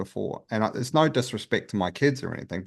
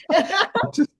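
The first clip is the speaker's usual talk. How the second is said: laughing; 4 dB louder than usual. Real, not acted. Laughing self-mockery.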